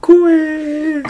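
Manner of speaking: high, strained, drawn-out wail. Real, not acted. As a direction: squeeze out a slow high wail. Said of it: "そんな声やったっけ".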